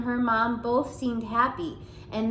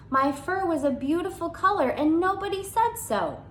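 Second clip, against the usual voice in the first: indignant; 8 semitones higher than usual. Acted. Hamster's hurt pride.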